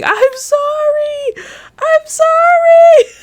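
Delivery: very high, drawn-out wailed apology; mock crying scream. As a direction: exaggerate a tearful shriek, slow and squealing. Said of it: "I was just screaming".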